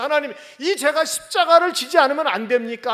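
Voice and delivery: 높고 크게 간청하는 목소리; 간절히 외치며 묻기; loud pleading. Acted. 예수님의 간청. (아들이 아버지 하나님께 크게 간청)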